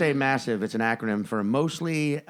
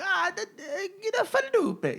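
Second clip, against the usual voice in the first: put-on a higher Italian accent, half crying. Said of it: "you think Italian".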